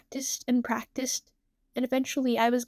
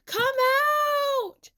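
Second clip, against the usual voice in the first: high, long-held calling out; searching shout. A narrator's reading. Tsukasa searching the park for Nene, shouting. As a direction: holler each word out long and high. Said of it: "Tsukasa shouting".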